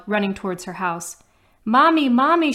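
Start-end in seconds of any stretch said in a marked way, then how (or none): 1.7-2.5 high, loud panicked call for Mommy; crying out. Acted. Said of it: "she cried, tears falling".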